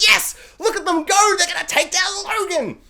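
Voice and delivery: root for them like a fan; high, excited fan cheer. Retold or imitated. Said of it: "I'm like".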